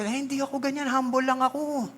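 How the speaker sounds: high, soft mock-humble voice. Preacher mimicking a falsely humble churchgoer, high and soft. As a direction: mimic a falsely modest member, lightly.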